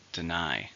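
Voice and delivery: "Deny" with the stress on its second syllable. Shown as a demonstration. Teacher modelling word stress for learners, "Deny" stressed on the second syllable. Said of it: "stresses in the second".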